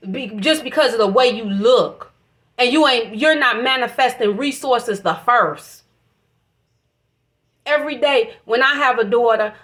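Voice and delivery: usual speaking voice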